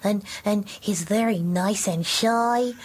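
slow, gentle, bashful bear voice; shy puppet bear. Shown as a demonstration. Puppeteer demos the bear for the host, slow and shy. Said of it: "And how does he talk?".

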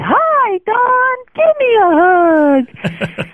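very high, slow, sing-song greeting; mock the pastor's squeaky welcome; teenage girl mocking the pastor's high voice, very high and slow; high-pitched pastor voice. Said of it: "Pastor Wayne would say".

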